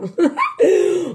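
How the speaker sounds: a quick amused laugh; brief real chuckle; short laugh. Real, not acted. Laughing at greedy viewers.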